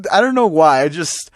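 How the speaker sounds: usual speaking voice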